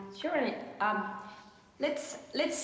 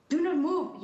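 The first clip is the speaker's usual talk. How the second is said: sharp, higher and louder order; a paramedic telling an injured stranger to stay still; called-out warning from a rescuer; urgent command; 6 semitones higher, 5 dB louder than usual. Acted. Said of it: "you heard".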